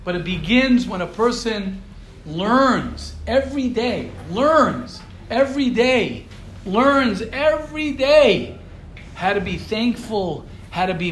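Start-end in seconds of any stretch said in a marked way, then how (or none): 2.2-8.6 preacher building "learns every day" to a peak; building emphasis. Acted. Builds repeated phrase rhetorically.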